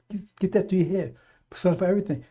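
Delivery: normal talking voice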